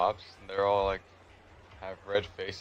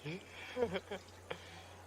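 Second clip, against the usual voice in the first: a real laugh; real laughter; 12 semitones higher, 9 dB quieter than usual. Real, not acted. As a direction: laugh at how absurd it was. Laughing at his own story.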